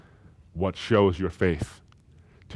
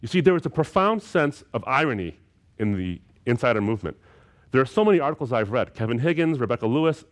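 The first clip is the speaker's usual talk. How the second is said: louder, higher, ringing preacher's climb; full-force preaching; 4 semitones higher, 4 dB louder than usual. Real, not acted. Preacher pressing the congregation on persecution, fervent full preaching. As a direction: preach it out with rising fervour.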